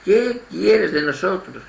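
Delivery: mild mock cry of a possessed man; retold demon's cry. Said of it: "se le puso a gritar a voces".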